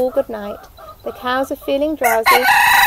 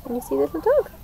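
plain reading voice

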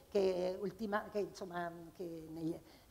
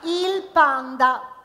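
drawn-out, louder title; slow title; 4 semitones higher, 9 dB louder, much slower than usual. Acted. Performer announcing the next poem title slowly. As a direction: stretch the title slowly for effect.

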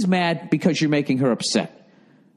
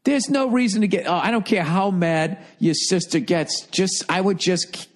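ordinary talk

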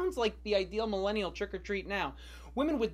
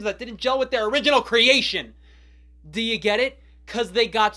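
much louder, pushing the pun hard; shouted punchline; 3 semitones higher, 10 dB louder than usual. Acted. Rapper yelling the punchline at the viewers. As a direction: belt the joke out.

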